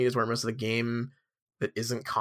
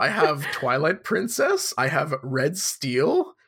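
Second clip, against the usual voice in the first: put-on kooky old Albus Dumbledore. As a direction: take your time like a dotty old wizard, pitch lilting up and down. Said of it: "an old guy voice for him".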